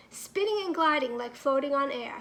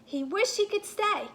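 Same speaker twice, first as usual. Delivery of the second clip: usual speaking voice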